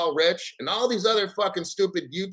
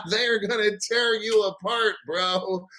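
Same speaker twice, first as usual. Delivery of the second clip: ordinary talk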